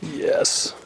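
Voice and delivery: hushed, voiceless little cheer; delighted whisper. Real, not acted. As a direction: whisper it in private triumph. Delighted seeing lights from front.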